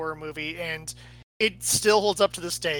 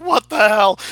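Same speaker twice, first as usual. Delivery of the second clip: loud startled what the hell; mock shocked; 3 semitones higher, 10 dB louder, slower than usual. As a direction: blurt it out in shock. Actors' genuine shocked reaction.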